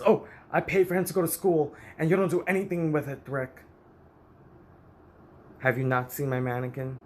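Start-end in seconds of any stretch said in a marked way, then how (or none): 0.0-3.7 higher, louder, fast mock of mother; complaining mother. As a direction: mimic the mother griping to the therapist. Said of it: "my mother goes to the therapist".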